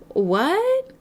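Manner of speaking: high-pitched, rising disbelief; incredulous what. Acted. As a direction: question it in mock astonishment, pitch jumping up. Disbelief at putting off purpose.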